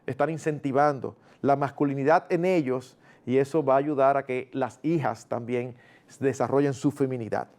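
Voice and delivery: ordinary talk, nothing marked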